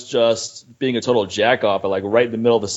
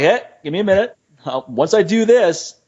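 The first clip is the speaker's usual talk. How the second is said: higher-pitched, put-upon request to wait; mock brush-off; 8 semitones higher than usual. Lifter to questioner before a lift, mock brush-off. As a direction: imitate holding someone off, pitched up.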